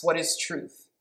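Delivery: a lightly voiced cynical Pilate; weary, doubting question. Pilate doubting Jesus before him, mildly cynical. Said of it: "you can hear the cynicism in Pilate's voice".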